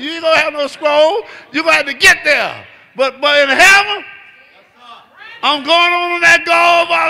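high, loud, shouting preacher's whoop; full-force preaching. Acted. Preacher whooping to the congregation about heaven, shouted high. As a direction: preach it to the rafters, voice climbing.

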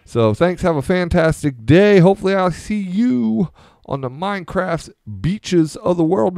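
high, bright YouTuber sign-off; hyped outro. Acted. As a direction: pump up the channel outro.

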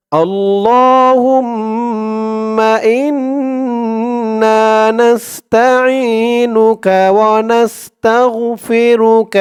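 drawn-out chanted Arabic; slow recitation. Shown as a demonstration. Teacher reciting the Arabic line slowly as a model. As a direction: chant each word slowly.

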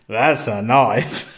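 drawn-out, hammy Australian movie line; bad Australian accent. Reviewer quoting the magic words from the movie, bad Australian accent. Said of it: "Sorry about the bad Australian accent".

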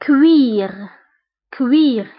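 كوير with wi sound; French cuir. Shown as a demonstration. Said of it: "تنطق ب وي".

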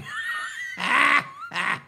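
laughing. Real, not acted. Laughs at own punchline.

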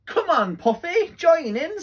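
high, loud, bright coaxing call; cheerful invitation. Acted. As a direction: call a friend over to play. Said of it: "said Barry".

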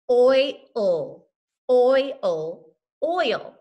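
loud oil split into its sounds; segmented oil. Shown as a demonstration. Teacher sounding out oil on her fingers for the class. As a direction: tap out each sound of oil. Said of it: "let's put those sounds on our fingers".

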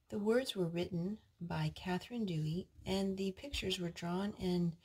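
soft, storybook reading voice; reading to children. Acted. Mother reading a lion story to her sons, soft narration. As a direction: read it gently to the little ones. Reads picture book aloud.

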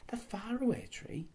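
"The faraway tree?" breathed high and hushed in awe; in wonder. Acted. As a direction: echo "faraway tree" as an amazed whisper. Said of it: "said Beth in wonder".

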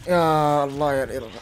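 rising despair at the repeated error; exasperated cry building. Real, not acted. Gamer crying out as the game errors again, building exasperation.

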